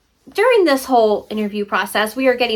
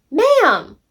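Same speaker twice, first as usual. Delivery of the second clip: sharp call to the cat, higher and louder; 7 semitones higher, 4 dB louder than usual. Real, not acted. Calling out to her cat.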